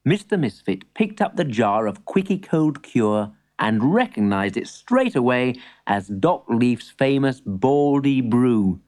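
usual speaking voice

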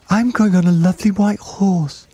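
put-on high-pitched delighted child; excited child's cry. A narrator's reading. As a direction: pitch it right up, a thrilled kid. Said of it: "cried another".

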